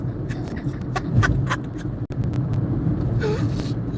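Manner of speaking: high, breathless sob; breaking down in tears; choked, high-pitched crying; real sobbing. Real, not acted. Breaks down over euthanized dog.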